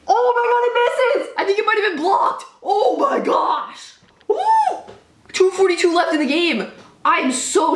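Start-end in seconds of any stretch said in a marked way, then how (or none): 0.1-3.9 real thrill at a missed kick; stunned excitement. Real, not acted. Missed field goal stuns him. 4.3-4.9 very high whooping cheer; high woo cheer. Real, not acted. Cheering the missed kick.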